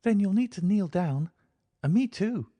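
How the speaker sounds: put-on high, childlike voice of a thirsty girl; a small girl speaking innocently; little girl. A narrator's reading. Girl asks her companion for water, childlike.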